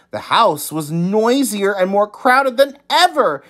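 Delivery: ordinary delivery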